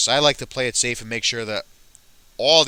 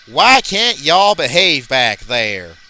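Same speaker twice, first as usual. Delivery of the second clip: loud, slow southern scolding; annoyed southern accent; 2 semitones higher, 7 dB louder, much slower than usual. Acted. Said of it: "look a little annoyed here".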